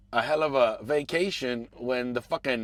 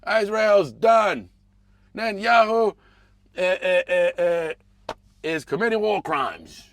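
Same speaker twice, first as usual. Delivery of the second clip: slow, louder mock fury with stuttering eh eh; angry old man; 5 semitones higher, 7 dB louder, slower than usual. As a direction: ham up a furious senior citizen ranting. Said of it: "All pissed off".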